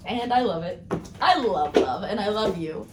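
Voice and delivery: normal talking voice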